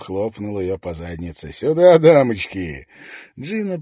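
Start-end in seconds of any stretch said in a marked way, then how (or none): 1.5-2.9 loud, slow cheeky call. A narrator's reading. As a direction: call the ladies over. Soldier calling out. (громкий развязный зов)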